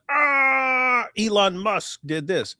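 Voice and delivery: high drawn-out yell, easing off; hammed-up outraged cry; mock enraged. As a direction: imitate a man raging over a name. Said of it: "enraged about".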